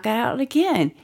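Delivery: ordinary talk, nothing marked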